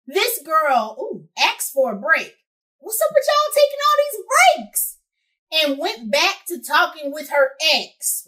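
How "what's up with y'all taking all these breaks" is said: high-pitched playful exasperation; mock exasperation. Real, not acted. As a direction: complain in a high, incredulous voice. Exasperated aside at listeners' many breaks.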